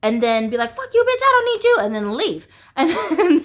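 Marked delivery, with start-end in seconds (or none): 0.7-1.8 high, louder angry outburst; imitating mom's high angry outburst; mock furious mom. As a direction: spit it out like the raging mother. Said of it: "piss her off".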